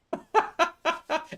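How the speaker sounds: high chuckle; real laugh. Real, not acted. Storyteller amused by the sparring memory, real laugh. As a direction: laugh at your own story.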